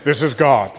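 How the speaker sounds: lightly voiced booming voice from heaven; a hint of a mock voice of God. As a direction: intone it slightly low and grand, mock-divine. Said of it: "it may not have been screaming out".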